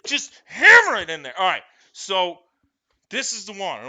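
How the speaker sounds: ordinary delivery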